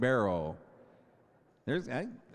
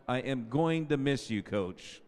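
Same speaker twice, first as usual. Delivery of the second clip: ordinary talk, nothing marked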